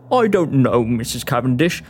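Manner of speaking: very low man's voice; low, wavering reluctance; doubtful monster hunter. Acted. Walton wavers.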